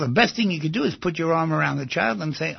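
normal talking voice

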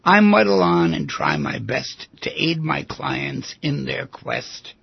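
slow, measured poem recital; poem reading. Acted. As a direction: savour each line of the poem slowly. Reads a psychiatrist's poem.

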